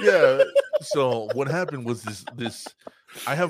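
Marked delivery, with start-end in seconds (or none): 0.0-0.4 burst of high laughter. Real, not acted. Laughing at the story's punchline.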